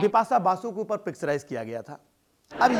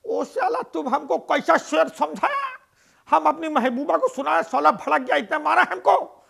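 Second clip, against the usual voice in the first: put-on Bengali accent; 7 semitones higher than usual. Beaten Bengali neighbour complains to Raju in a Bengali accent. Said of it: "क्या हुआ दादा".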